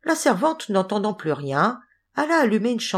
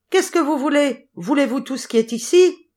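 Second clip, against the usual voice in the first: high, raised storybook shout; crying out; 8 semitones higher, 4 dB louder than usual. A narrator's reading. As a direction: call out high as if to be overheard. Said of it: "il cria de nouveau".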